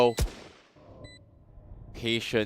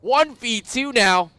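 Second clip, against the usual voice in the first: high, loud excited play-by-play; esports caster; 11 semitones higher, 7 dB louder than usual. Real, not acted. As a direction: call the action at full hype. Hyped play-by-play of a clutch.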